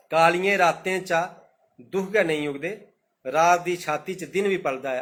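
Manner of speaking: slow, measured verse reading; poem recitation. Acted. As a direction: let each line of the verse land. (कविता पाठ, होलें होलें)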